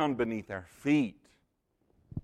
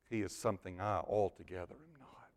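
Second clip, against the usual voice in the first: barely voiced whisper; awed whisper; 4 semitones lower, 8 dB quieter than usual. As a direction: breathe it out in awe. Preacher whispers Simon's realization.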